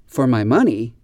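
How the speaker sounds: "money" stressed, "my" left light; wrong stress on MONEY. Shown as a demonstration. Teacher demonstrating the unnatural version to learners, "money" stressed. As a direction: put the weight on "money" as the wrong example. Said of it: "We never say".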